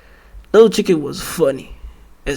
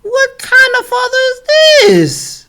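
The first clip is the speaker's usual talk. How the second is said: high-pitched disbelief, dropping on the last word; incredulous outburst. Real, not acted. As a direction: squeal the question in outraged disbelief. Appalled at the father's choice.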